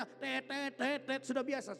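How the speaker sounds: short repeated horn toots; mimicked car horn; imitating car horns. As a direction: honk like impatient drivers. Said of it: "mau masuk gang saja horn".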